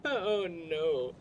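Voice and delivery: normal talking voice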